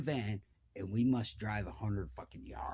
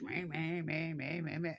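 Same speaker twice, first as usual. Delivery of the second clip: repeated higher-pitched whining buzz; imitated chainsaw; 5 semitones higher, 3 dB quieter than usual. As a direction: mimic a saw revving over and over.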